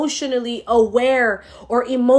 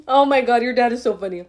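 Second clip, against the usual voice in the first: ordinary talk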